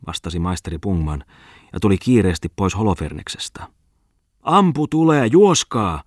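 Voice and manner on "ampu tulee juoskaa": high, loud urgent yell; frantic alarm call; shouting warning. A narrator's reading. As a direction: shout to make them run. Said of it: "huusi hän".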